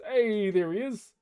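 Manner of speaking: ordinary delivery, nothing marked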